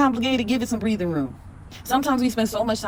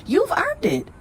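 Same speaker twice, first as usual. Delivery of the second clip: excited. Real, not acted. Hype peak of card reading.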